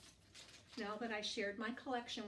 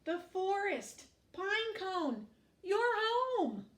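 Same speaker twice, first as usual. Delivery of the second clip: much higher, louder joyful cries; excited arrival; 10 semitones higher, 6 dB louder, slower than usual. Acted. Penguin bringing Pinecone home, thrilled excited cries. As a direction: burst out in delight at reaching home.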